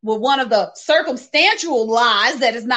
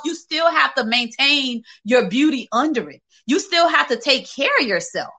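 ordinary talk, nothing marked

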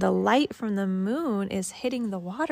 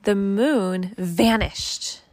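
slow, louder storybook line; slow story reading; 6 dB louder, slower than usual. Acted. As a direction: let the moment land slowly. Parent reads picture book aloud.

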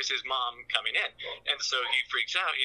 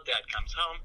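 ordinary talk, nothing marked

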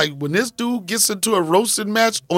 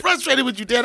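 high mock child voice; pitched-up kid impression; frustrated little son; 9 semitones higher than usual. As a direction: imitate a small boy's huffy complaint. Repeats his son's frustrated complaint.

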